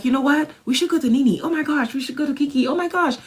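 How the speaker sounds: high, breathless oh-my-gosh gushing; excited. Retold or imitated. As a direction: rattle off plans in rising excitement. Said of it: "I'm like".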